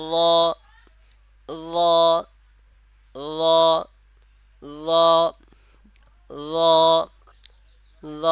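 ল said over and over, each held one alif; repeated letter drill. Shown as a demonstration. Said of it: "যেমন আবার বলতেছি". (ল হরফ বারবার এক আলিফ টেনে অনুশীলন)

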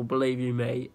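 normal talking voice

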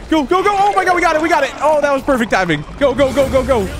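high-pitched excited gamer rush; excited urging. Real, not acted. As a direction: cheer and urge yourself on. Urgent escape after landing a hit.

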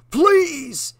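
high, urgent begging voice; pleading. A narrator's reading. Trapped Jesse begging the girl to end it. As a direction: beg her with everything left. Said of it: "he pleaded".

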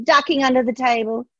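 plain reading voice

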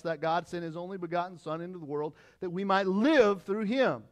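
loud, high insistent preaching; full-voiced pulpit preaching; preaching at full force. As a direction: drive the point home to the congregation. Loud scripture reading and preaching.

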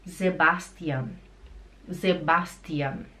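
"Sebastian" ending in -an, no nasal ão; German pronunciation. Shown as a demonstration. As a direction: say "Sebastian" as ze-BAS-tian. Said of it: "no alemão não existe o ão".

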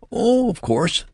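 very high, hysterical nurse; hysterical cry. A narrator's reading. Terrified nurse answering the new father, hysterically. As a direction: cry it out high, on the edge of panic. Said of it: "she cried hysterically".

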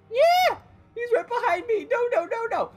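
high, loud panicked yelling; panicking scream. Real, not acted. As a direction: shriek in real panic. Chased in game, screaming.

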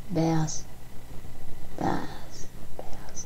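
breathy under-the-breath answer; whisper. Real, not acted. Player whispering his answer to the screen, hushed. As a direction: whisper the word to yourself.

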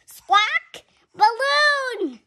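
high, loud squawking parrot; loud shrill bird call. Acted. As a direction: screech it like a parrot. Child voicing parrot call.

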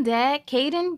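ordinary delivery, nothing marked

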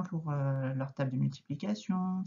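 usual speaking voice

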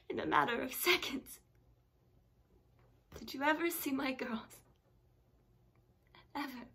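breaking voice, dropping to a whisper at the end; gasping breaths between anguished questions; distraught mother. Acted. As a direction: accuse the doctor through rising distress. Patient challenges doctor about losing children.

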